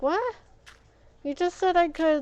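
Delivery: high, loud indignant protest; indignant what. Retold or imitated. To staff taking back Spanish, high indignant protest. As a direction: call out the broken promise.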